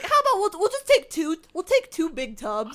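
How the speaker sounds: high fast put-on parent; parent quoted. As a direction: sensible parent cutting her off. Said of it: "And, like".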